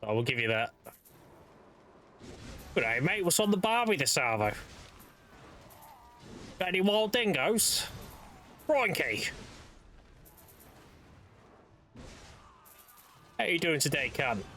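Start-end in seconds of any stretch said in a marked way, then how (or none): 2.7-14.4 lightly put-on Aussie greeting; hint of a hammed-up Australian twang; mock Australian accent. Shown as a demonstration. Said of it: "Not Australian".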